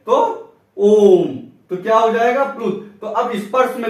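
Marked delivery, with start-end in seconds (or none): 0.8-1.4 ओम stretched very long and loud; drawn-out om. Shown as a demonstration. Teacher demonstrating a prolonged vowel: ओम drawn long. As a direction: hold ओम as long as you can. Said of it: "और वहीं लंबा खींचे, देर तक बोला जाए".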